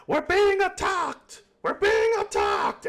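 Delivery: high panicked shout of alarm. Acted. Crew member shouting to the ship, panicked alarm. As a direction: yell the warning to the whole crew.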